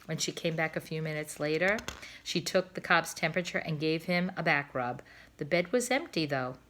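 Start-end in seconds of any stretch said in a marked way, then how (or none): none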